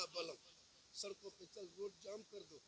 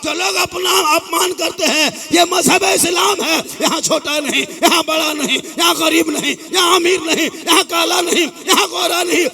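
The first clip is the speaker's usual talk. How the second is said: loud, high impassioned preaching; fiery preaching; 8 semitones higher, 15 dB louder than usual. Acted. Cleric to a Muharram gathering, loud impassioned preaching. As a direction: preach it out to the whole crowd. Preacher at full sermon pitch.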